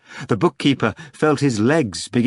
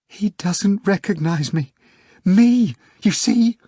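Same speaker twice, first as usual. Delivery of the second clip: wailing secretary; 9 semitones higher than usual. A narrator's reading. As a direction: wail it out, hurt and lost. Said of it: "wailed the secretary".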